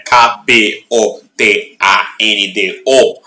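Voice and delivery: letter by letter, slow; spelling it out. Shown as a demonstration. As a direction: spell out K P O T A N D O slowly. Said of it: "spelling the handle".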